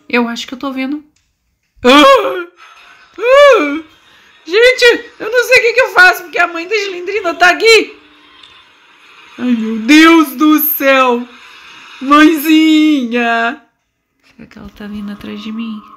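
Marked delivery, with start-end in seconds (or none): 1.8-3.8 being chased. Real, not acted. Mother appears, player flees. 4.5-13.6 high panicked nervous laughing; being chased. Real, not acted. Monster mother is near.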